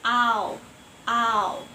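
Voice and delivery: ow repeated loudly in an American accent. Shown as a demonstration. Student echoing the recording, American ow. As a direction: copy the American ow after the clip. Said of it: "the way how American accents say".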